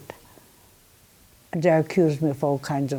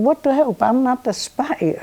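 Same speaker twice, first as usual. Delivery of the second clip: higher, exasperated protest; indignant retort; 8 semitones higher than usual. Retold or imitated. Survivor reenacting her protest to the FBI, indignant. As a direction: push back with retold indignation.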